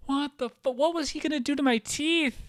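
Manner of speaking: shocked outburst. Retold or imitated. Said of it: "I'm like".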